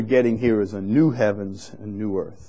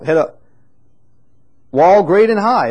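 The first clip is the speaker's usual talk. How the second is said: scripture reading; 5 semitones higher, 5 dB louder than usual. Acted. Rereading the verse with emphasis.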